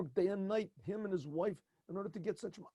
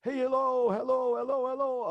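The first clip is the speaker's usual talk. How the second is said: calling out; 8 semitones higher, 10 dB louder than usual. Retold or imitated. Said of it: "he hears somebody yelling".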